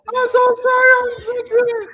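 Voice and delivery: very high, loud squeaky fake sob; mock crying apology. Mocking the woman who turned in a stranger, hammed-up crying. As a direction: wail it as a hammed-up weepy imitation. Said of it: "How you gonna cry and say".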